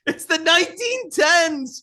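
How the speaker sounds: high, slow, loud cry; hammed-up tearful shouting; mock wailing mother. Mother to the time traveler in the nineteen tens, mock wailing. Mother wails about medical care.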